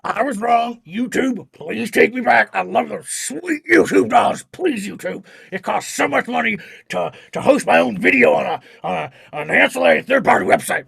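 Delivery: loud, pleading rant; hammed-up desperate plea; Alex Jones. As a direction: beg like a strained conspiracy host. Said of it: "Alex Jones goes nowhere".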